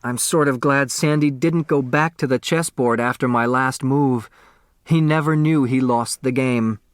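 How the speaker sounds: usual speaking voice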